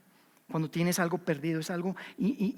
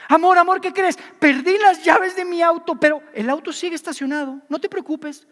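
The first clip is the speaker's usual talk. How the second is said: put-on higher and louder, chirpy girlfriend voice; girlfriend on the phone; 8 semitones higher, 8 dB louder than usual. Preacher mimics a fiancée calling about lost keys, high, loud and chirpy. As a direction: gush it like the fiancée with happy news. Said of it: "te llama tu novia y te dice".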